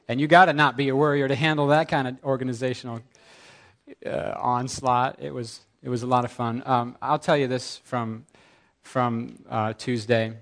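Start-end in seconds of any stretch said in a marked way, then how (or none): none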